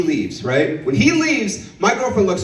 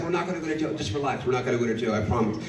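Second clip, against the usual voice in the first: soft, lowered soothing voice; calm reassurance; 5 semitones lower, 8 dB quieter, faster than usual. Acted. Boyfriend calming crying girlfriend about jail, soft reassurance. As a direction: settle her down quietly.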